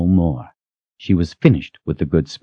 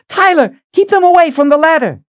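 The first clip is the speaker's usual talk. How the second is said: shouting; 5 dB louder than usual. Acted. Shouting during the fight.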